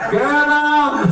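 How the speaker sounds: high, stretched crowd chant; egging him on. Acted. Store crowd egging on the owner, high jeering cry. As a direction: egg him on like a heckling crowd. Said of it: "they was egging him on".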